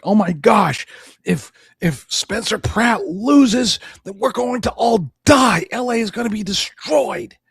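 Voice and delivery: high, slow hammed-up panicky wail; mock worked-up panic. Mocking people panicking over Spencer Pratt, hammed-up panic. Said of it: "all worked up and be like".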